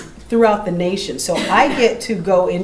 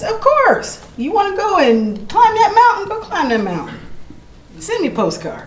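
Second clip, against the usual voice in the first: matter-of-fact; 13 semitones higher, 4 dB louder than usual. Shown as a demonstration. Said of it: "they need to hear it matter-of-factly".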